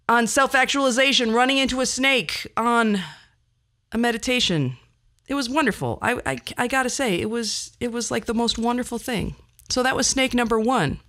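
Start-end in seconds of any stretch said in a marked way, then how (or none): none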